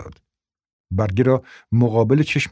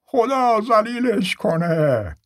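slow high curse; 11 semitones higher, much slower than usual. Acted. Cursing from inside the house.

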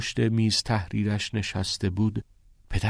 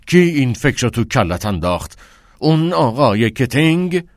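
louder, higher furious demand; raised angry voice; 4 semitones higher, 7 dB louder than usual. A narrator's reading. Father raising his voice at Neil, angry. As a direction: raise your voice in anger. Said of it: "صدایش را بلندتر کرد".

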